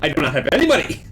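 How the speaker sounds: high, loud, insistent protest; mock emphatic disclaimer. Game master wards off a copyright claim, mock emphatic. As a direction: plead it loudly, in jest.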